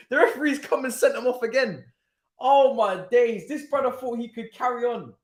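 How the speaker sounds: usual speaking voice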